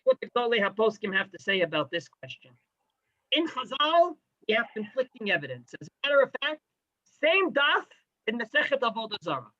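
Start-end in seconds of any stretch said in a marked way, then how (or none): none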